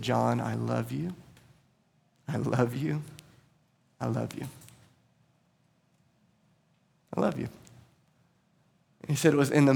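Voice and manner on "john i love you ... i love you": gentle voice. Retold or imitated. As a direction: hush it like a loving father. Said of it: "it was a gentle voice that said".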